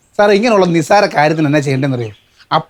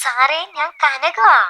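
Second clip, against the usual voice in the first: slow, high coaxing on the phone; slow, higher-pitched phone caller; drawn-out reintroduction; 7 semitones higher, much slower than usual. Acted. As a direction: stretch the name out for him, pitched up. Repeats name, not recognised.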